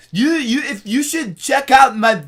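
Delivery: high, loud, slow plugging voice; drawn-out high plea; imitating the guy. The stranger plugging his channel, high and slow. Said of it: "And he's like".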